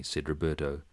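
ordinary talk, nothing marked

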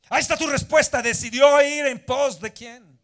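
grito agudo a todo pulmón; grito de predicador. Real, not acted. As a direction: suelta la respuesta gritando con fuerza. Predicador lanza la respuesta a gritos. (exclamación a gritos, muy aguda)

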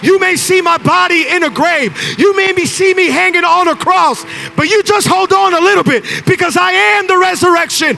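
loud, high, shouted sermon climax; full-force preaching. Real, not acted. Pastor rallying the congregation about the resurrection, full-force preaching. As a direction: preach it with everything you have, building up.

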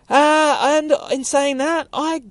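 slow, very high put-on voice; high sign-off. Host wrapping up the show, high put-on voice. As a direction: stretch it out way up high.

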